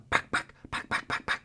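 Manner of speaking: sharp clucking cut; voiceless clucks like a turkey hen. Shown as a demonstration. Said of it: "A cut's a really sharp cluck".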